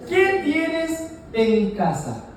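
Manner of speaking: very slow, spaced-out "¿Qué tienes en casa?"; deliberate, word-by-word "¿Qué tienes en casa?"; slow deliberate question. Retold or imitated. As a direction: draw out "qué", "tienes" and "en casa", pausing between. Prophet's question, declaimed for congregation.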